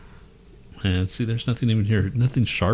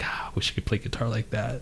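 wistful whisper; breathy mock longing, whispered; 2 semitones higher, 9 dB quieter than usual. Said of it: "I was like".

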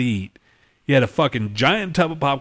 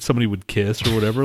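ordinary talk, nothing marked

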